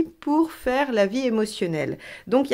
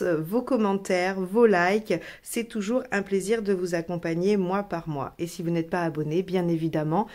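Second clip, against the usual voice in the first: usual speaking voice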